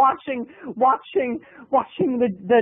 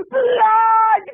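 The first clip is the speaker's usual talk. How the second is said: high, loud shriek; horrified shout; 11 semitones higher, 5 dB louder than usual. Acted. Comic shrieks at the bloody bathroom. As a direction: scream it in mock horror.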